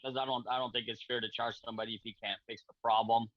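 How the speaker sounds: ordinary delivery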